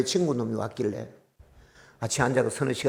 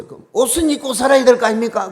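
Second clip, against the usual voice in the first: high, loud indignant demand; loud protest; 10 semitones higher, 9 dB louder than usual. Retold or imitated. Pastor demanding clothes from God, loud indignant protest. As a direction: press the demand hard.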